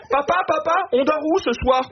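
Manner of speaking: a hint of a child; child's voice. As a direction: play a small child pestering dad. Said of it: "Ou alors".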